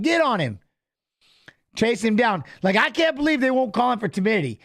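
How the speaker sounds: ordinary delivery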